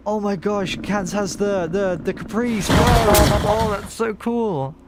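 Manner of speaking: high, gushing delight; excited. Real, not acted. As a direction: rave about it, loud and high. Gushing over the Caprice.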